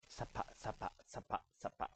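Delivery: whispered sapa sapa scrubbing sound; scrubbing onomatopoeia. Shown as a demonstration. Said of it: "That's the sound of scrubbing".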